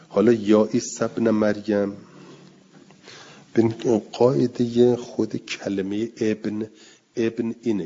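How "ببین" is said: usual speaking voice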